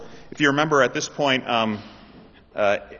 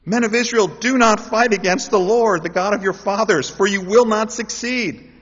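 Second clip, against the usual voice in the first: solemn battle warning; 9 semitones higher, 6 dB louder than usual. Acted. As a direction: warn the enemy army from the hilltop. King's warning to Israel's army.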